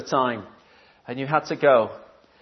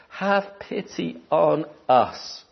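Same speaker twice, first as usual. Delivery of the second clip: slow pleading; 6 dB quieter, much slower than usual. Retold or imitated. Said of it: "he's the one who has a master".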